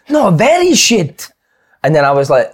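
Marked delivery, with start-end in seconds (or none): 0.1-1.3 slow, high Italian manager voice; Italian accent. Retold or imitated. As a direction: drag it out like Di Canio. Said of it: "he goes".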